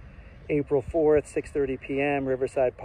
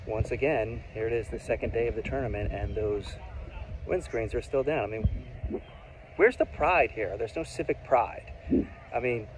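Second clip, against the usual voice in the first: ordinary delivery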